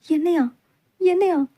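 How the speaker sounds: rising startled "me?" twice; shocked disbelief. Acted. Bride stunned at being chosen, shocked. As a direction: gasp it out, can't believe it.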